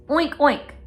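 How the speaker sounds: put-on playful piggy oink for the kids; bright oink oink sound. Shown as a demonstration. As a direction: oink like a cartoon pig. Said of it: "And he says".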